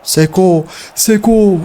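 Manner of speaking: urgent shout; excited calling. Real, not acted. Calling striker during attack.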